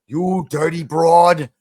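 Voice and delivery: Cagney-style tough guy. Retold or imitated. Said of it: "James Cagney is like".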